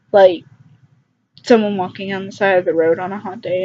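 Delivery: ordinary delivery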